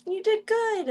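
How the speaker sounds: very high pet-talk voice; cooing praise. Real, not acted. Streamer praising the baby before it goes back to mommy, cooing.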